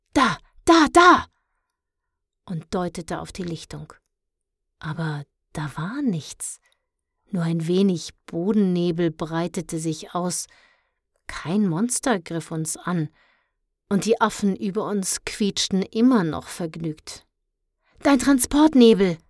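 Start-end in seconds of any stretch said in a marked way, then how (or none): 0.1-1.3 loud, high, urgent cries; alarmed shout. A narrator's reading. Mondstärker points Luna to the clearing, shouting urgently. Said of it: "da rief Mondstärker". 18.0-19.2 loud, higher, urgent call. A narrator's reading. Mondstärker pushes Luna toward her fog, calling urgently. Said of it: "rief Mondstärker".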